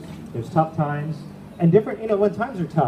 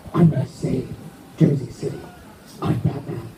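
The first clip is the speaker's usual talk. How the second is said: Batman voice. Retold or imitated. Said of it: "you sound a bit like a Joker".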